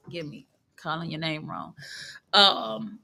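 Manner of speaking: normal talking voice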